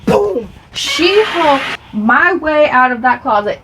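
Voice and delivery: slow, loud and high, bursting with fury; She-Hulk burst. Retold or imitated. Telling viewers how she smashed out of the closet, mock fury. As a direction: stretch each word like smashing out.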